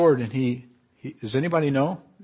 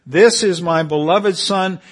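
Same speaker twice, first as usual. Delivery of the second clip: slow, booming, louder and higher; voice from the cloud; 6 semitones higher, 11 dB louder, slower than usual. Acted. God speaking from the cloud to the disciples, booming. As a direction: proclaim it like God from heaven, swelling at the end.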